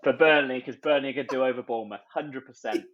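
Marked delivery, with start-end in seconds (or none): none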